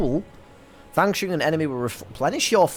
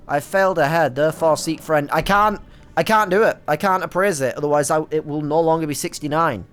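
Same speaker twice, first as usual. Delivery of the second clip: ordinary delivery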